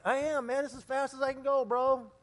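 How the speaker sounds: lightly acted, high-pitched excuse; mock protest. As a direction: protest that you're already trying. [name] protests when told speed up.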